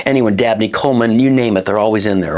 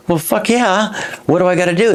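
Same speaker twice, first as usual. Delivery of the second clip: excited; 8 semitones higher than usual. Retold or imitated. Thrilled at meeting the president.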